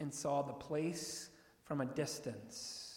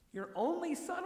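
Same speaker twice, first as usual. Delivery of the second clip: much higher, puzzled question on "son"; incredulous question; 11 semitones higher than usual. Shown as a demonstration. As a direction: pitch "your only son?" up high as if puzzled. Questioning the words in disbelief.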